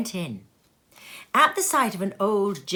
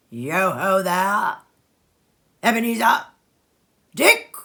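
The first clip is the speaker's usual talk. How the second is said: put-on rich, jovial old man calling out louder; a fat, comfortable master hailing his lads; 7 dB louder than usual. A narrator's reading. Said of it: "called out in a comfortable, oily, rich, fat, jovial voice".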